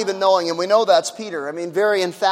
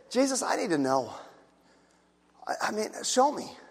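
quiet prayer; 8 dB quieter than usual. Retold or imitated. Voicing a sincere seeker's prayer.

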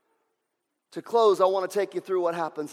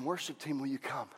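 ordinary talk

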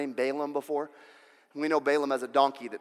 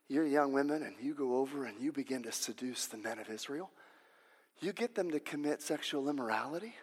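sly whisper-like scheme; 8 dB quieter than usual. Acted. Prophet quietly shares scheme.

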